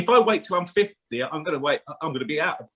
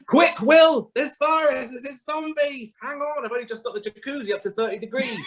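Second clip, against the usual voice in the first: high, urgent comic film voice; hammed-up movie panic, much higher; mock alarm; 11 semitones higher than usual. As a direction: warn Will of zombies in a rush. Film character panicking in jacuzzi.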